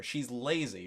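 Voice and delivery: normal talking voice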